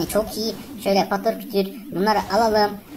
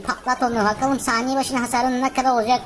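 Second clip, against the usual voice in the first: ordinary talk, nothing marked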